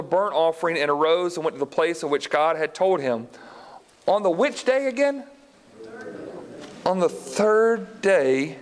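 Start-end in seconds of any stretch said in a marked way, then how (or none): none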